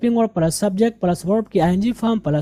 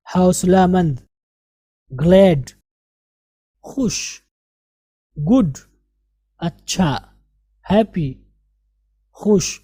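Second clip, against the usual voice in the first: usual speaking voice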